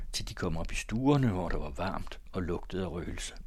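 ordinary delivery

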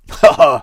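loud, short guffaw; skoggerlatter. A narrator's reading. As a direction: burst out with a scornful haha. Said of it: "svarede skytten med en skokker". (høj skoggerlatter)

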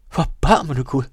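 loud cry of horror; shocked shout. A narrator's reading. Cornelius hears she jumped into the sea, shouting. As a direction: exclaim it loudly, aghast. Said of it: "råbte Cornelius".

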